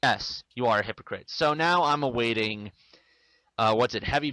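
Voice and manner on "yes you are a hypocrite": ordinary delivery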